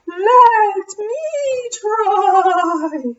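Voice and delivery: high, slow, wavering ghost wail. Acted. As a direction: draw it out like a moaning ghost.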